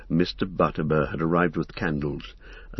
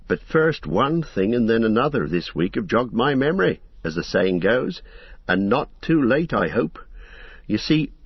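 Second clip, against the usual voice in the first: fussy innkeeper; 6 semitones higher than usual. Acted. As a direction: chatter on like a busy, apologetic landlord. Landlord voiced in the story.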